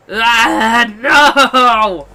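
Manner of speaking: drawn-out, high furious scream; Hongo losing it. Acted. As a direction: howl "no" at full volume. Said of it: "Hongo had lost it".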